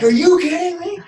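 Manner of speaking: very high, incredulous retold outburst; livid. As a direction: act out livid disbelief, pitch shooting up. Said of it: "I'm even more livid at this point".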